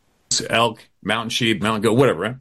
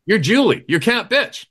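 high, bossy ribbing; mock teasing; 8 semitones higher than usual. Guide nicknaming the teenage wrangler, teasing. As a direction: rib the kid with mock authority.

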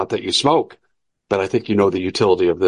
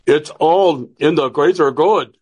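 slow, higher mock German accent; Klaus Schwab impression; 4 semitones higher, slower than usual. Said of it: "It's my Klaus Schwab imitation".

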